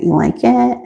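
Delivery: normal talking voice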